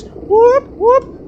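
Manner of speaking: high whooping siren noise. Pretend. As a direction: imitate a police siren, high. Mock police siren again.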